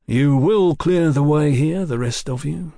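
loud, measured command to clear off; firm announcement. A narrator's reading. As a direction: sergeant taking charge of a gang. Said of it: "Fraser announced".

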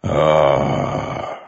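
very slow, immense godlike voice; Storm Father's voice. A narrator's reading. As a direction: let each word roll like distant thunder. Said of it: "the Storm Father said".

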